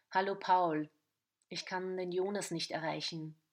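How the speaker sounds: usual speaking voice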